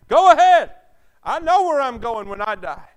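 very loud, high preaching cry; full-force pulpit shout; triumphant shout. Acted. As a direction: shout your certainty.

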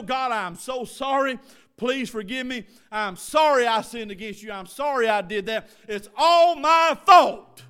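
impassioned plea building to a drawn-out shout; plead, then cry out the admission; fervent confession. Shown as a demonstration. Models a repentant confession to God.

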